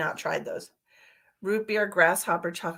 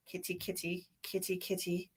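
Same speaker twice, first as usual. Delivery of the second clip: softly calling the cat, much softer; 14 dB quieter than usual. Real, not acted. Calling her cat Lucky over, soft coaxing. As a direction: coax the kitty over gently.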